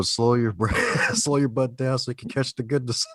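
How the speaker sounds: repeating the guest's line through laughter; laughing quote. Retold or imitated. Podcast host repeating guest's funny line to her, laughing through it. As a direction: quote her words back, cracking up. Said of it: "that's probably gonna be the quote".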